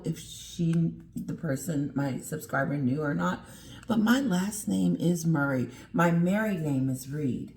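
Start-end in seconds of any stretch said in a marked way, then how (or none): none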